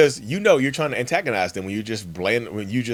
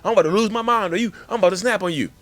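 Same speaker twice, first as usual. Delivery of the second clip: high-pitched, agitated narcissist; acted exasperated rant, pitched way up; about to snap; 10 semitones higher, 4 dB louder, faster than usual. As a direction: work yourself up toward losing it. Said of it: "Now they're gonna snap on you".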